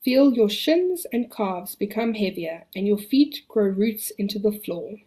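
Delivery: calm the listener, long pauses. Acted. Leads a relaxation exercise.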